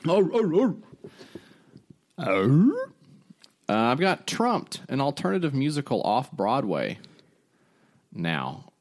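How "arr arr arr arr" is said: gruff pirate growl; pirate arr growl. Pretend. Pirate growl after hype.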